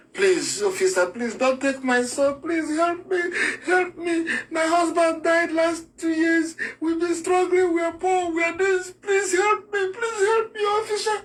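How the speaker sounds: high crying plea, breaking into sobs, some words dragged slow; desperate begging. Acted. Young mother begs the officer to spare her son, crying high. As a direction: beg for your son through tears.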